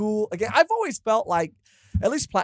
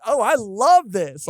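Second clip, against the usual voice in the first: drawn-out delighted gush, slightly higher and louder; excited; 4 semitones higher, 5 dB louder, slower than usual. Retold or imitated. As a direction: savor each word, thrilled. Retold excited reaction.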